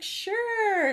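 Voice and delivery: a sing-song yes with a hidden grin; chirpy mock agreement. Mom answering her son's chart request, chirpy and high. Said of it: "I was like".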